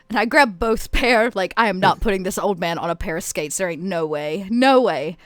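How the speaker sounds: usual speaking voice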